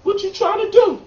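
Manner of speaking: lightly voiced suspicious critic; mock their jealous challenge; imitating accusers. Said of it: "some Nuwaubians was like".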